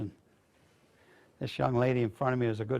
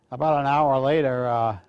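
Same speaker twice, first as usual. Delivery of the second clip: usual speaking voice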